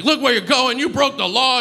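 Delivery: high-pitched mock yell, no real volume; high, scolding imitation of screaming; retold angry screaming. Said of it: "he started screaming at me".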